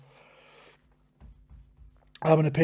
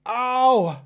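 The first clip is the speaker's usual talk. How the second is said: sharp pained ow; 8 semitones higher, 4 dB louder than usual. Real, not acted. Lip burning from hot pepper.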